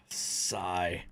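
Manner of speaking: low, soft spoken sigh; deflated. Real, not acted. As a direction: say it low and quiet, let down. Says sigh aloud after sighing.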